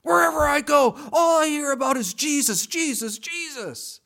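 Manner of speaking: loud, high shouted rant; angry shout. Retold or imitated. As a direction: explode with exasperation at hearing about Jesus. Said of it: "She was so angry".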